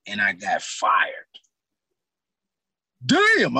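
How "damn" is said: mad damn. Real, not acted. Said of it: "I was mad".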